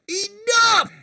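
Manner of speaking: very high-pitched booming cry; booming shout. Acted. Game master echoing the player's spell, high booming shout. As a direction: boom it out high over the whole market. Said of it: "with your booming voice, just shout".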